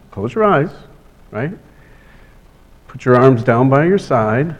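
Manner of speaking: soft, calm breathing guidance; guided breathing. Acted. Leads a breathing exercise.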